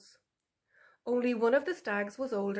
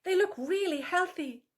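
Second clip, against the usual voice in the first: higher, louder sweet-talking fox; butter up the sow with a higher voice; appeasing vixen; 8 semitones higher, 3 dB louder than usual. A narrator's reading. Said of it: "Tula said".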